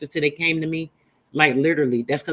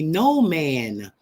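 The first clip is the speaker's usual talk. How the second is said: ordinary talk, nothing marked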